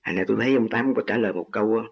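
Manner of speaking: normal talking voice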